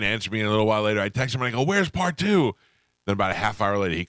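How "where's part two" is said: high, pushy question; mock impatient nagging; impatient demand. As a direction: whine for the next part. Demanding the rest by text.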